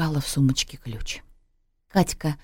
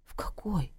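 very soft inner voice; listless; 5 semitones higher, 13 dB quieter than usual. A narrator's reading. Mother wondering about a hotel at night, listless and soft. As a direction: think it aloud limply, nearly whispered. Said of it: "вяло подумала она".

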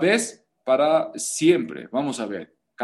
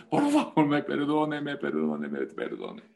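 mock pleading. Imitating members begging forgiveness.

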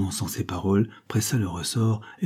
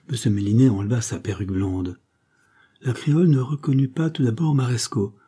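ordinary delivery

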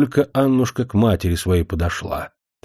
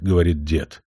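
plain reading voice

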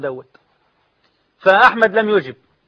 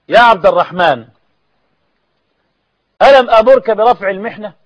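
calling out; 3 semitones higher, 6 dB louder than usual. Acted. Caliph summoning Abd al-Rahman, loud rebuking call. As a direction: call across the court and scold. Caliph calls to his man.

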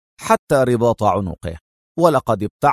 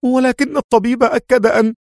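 frightened cry; 11 semitones higher than usual. A narrator's reading. Woman objects as he rises, high and frightened. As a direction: protest in fright. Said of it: "صاحت مذعورة".

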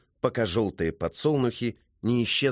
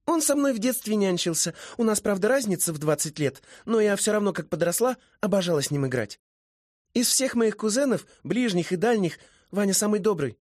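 put-on high, lively girlish character voice; high-voiced, sociable young mermaid; chatty young woman; 10 semitones higher than usual. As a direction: play a chatty girl, pitched up.

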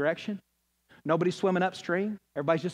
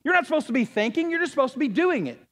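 much higher, louder mock crowd yelling; crowd yelling you back; 10 semitones higher, 5 dB louder than usual. Crowd scolding the one who turns back, a loud mock yell. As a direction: shout it like the whole crowd pushing you back in line. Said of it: "They go".

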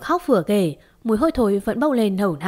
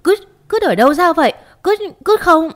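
high, loud disgusted shriek; 9 semitones higher, 7 dB louder than usual. A narrator's reading. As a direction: scream in horror at the dung. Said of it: "ả ta hét toáng lên".

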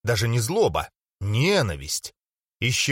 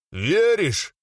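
pressing demand; 6 semitones higher than usual. Acted. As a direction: push harder for the answer. Repeats question, pressing.

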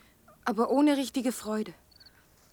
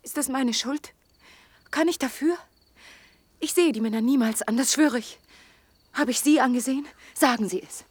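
louder, higher, defensive outburst; upset protest; 3 semitones higher, 6 dB louder than usual. Acted. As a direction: throw his doubt back at him. She defends herself, upset. (aufgebrachter, lauter Protest)

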